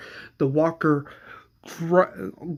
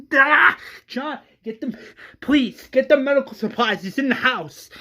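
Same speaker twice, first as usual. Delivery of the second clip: much higher, frantic orders; freaking out; 10 semitones higher, 4 dB louder than usual. Acted. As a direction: panic while barking the orders. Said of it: "As Tara is freaking out".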